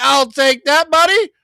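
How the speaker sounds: high, loud mock player voice; cocky quarterback. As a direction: imitate the player casually claiming the ball. Voices the quarterback grabbing the ball.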